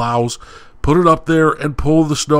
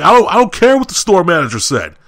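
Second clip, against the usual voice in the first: raised, heated demand at the counter; retold angry outburst; 6 semitones higher, 4 dB louder than usual. To the McDonald's assistant over the damaged car, angry raised voice. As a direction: cut him off and lay down an ultimatum, loud. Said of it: "I cut him off".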